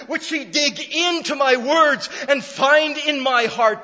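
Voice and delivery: loud, high, ringing pulpit voice; full-force preaching. Acted. Pastor pressing the congregation with full-force preaching. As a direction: preach it out with fire.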